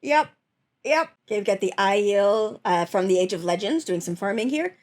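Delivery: ordinary delivery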